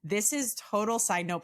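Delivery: normal talking voice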